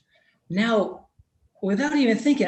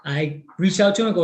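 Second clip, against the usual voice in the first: ordinary delivery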